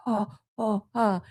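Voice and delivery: groaning in pain. Retold or imitated. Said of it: "grabbing his back, and he's like".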